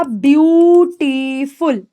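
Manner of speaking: ब्यूटीफुल drawn out in three parts, ब्यू-टी-फुल; teacher sounding out ब्यूटीफुल syllable by syllable; syllable breakdown. Shown as a demonstration. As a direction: stretch each syllable of ब्यूटीफुल slowly. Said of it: "अगर मैं इसको तोड़ दूं".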